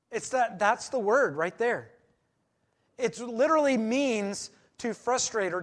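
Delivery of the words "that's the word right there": usual speaking voice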